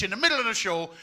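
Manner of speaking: ordinary talk, nothing marked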